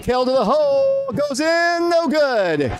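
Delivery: high, excited play-by-play burst; breathless rush of a big moment; excited call. Real, not acted. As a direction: jump up as he drives. Drive to the basket.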